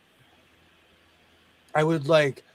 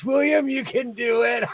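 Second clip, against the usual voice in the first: cheering shout; 8 semitones higher than usual. Real, not acted. Cheering a friend on.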